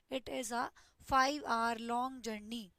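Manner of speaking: very slow, word by word; slow careful repetition; slow dictation. Shown as a demonstration. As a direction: read it again slowly for the class. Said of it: "ठीक है".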